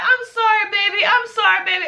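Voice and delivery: high-pitched mock-mother apology; high, repeated mom-voice apology; imitating mama. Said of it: "And she's like".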